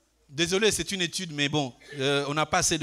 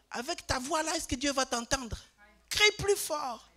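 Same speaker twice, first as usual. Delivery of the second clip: lightly voiced pastor urging more volume; imitating the visiting pastor; 8 semitones higher than usual. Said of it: "un pasteur est venu à l'église". (imitation d'un pasteur, lente et plus aiguë)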